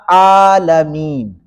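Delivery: عالمین with the opening "aa" held long; عالمین drawn out, slowly; long vowels. Shown as a demonstration. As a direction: stretch the vowels of عالمین for over a second. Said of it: "ڈیڑھ second تک لمبا کیا جاتا ہے".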